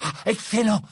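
high, loud, greedy delight; eager exclamation. Acted. Ron grabbing the glass of antidote, avid. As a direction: pounce on it eagerly. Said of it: "s'exclama Ron avide".

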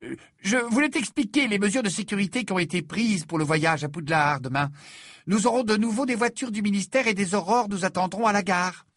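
high, loud bustling mother; brisk Mrs Weasley. Acted. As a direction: play the busy mother laying out the plan. Mrs Weasley voiced by narrator.

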